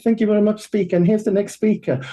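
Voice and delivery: awkward host. Retold or imitated. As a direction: squeak out the host lines like an anxious introvert. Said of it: "And I was literally like".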